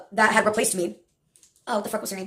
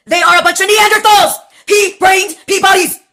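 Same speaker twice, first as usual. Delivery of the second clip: raised, louder insult, slowing on the last words; scornful rant; 7 semitones higher, 6 dB louder than usual. Real, not acted. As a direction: heap contempt on them, higher and louder. Insulting the doctors' profession.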